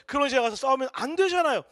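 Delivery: normal talking voice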